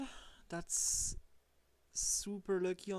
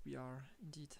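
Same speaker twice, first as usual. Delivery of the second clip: breathy hush of agreement; a soft conspiratorial whisper; 5 semitones lower, 12 dB quieter, faster than usual. Acted. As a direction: whisper it like a secret. Agreeing in a hushed aside.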